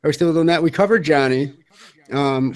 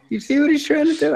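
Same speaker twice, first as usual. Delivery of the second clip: jumps way up in pitch; high mock voice; 13 semitones higher than usual. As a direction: squeak the question out high. Repeated at much higher pitch.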